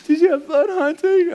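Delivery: very high put-on wife voice; hammed-up hurt question; imitated upset wife. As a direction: pitch it way up, mock-wounded. Imitates hurt wife after his return.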